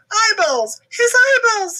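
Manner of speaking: high loud panic; frantic high shriek; panicked scream. Acted. Bibi panics.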